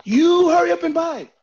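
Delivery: high, loud impersonation of impatient staff; mad restaurant staff. Retold or imitated. Mimicking restaurant staff rushing late diners out, mock-angry shout. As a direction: pitch it up and yell, hammed-up.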